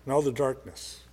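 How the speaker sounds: in all the darkness echoed low and soft; hushed echo. Shown as a demonstration. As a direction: let it sink into a near hush. Repeated phrase, loud then hushed.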